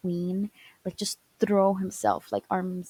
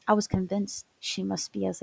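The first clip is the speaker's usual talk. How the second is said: ordinary talk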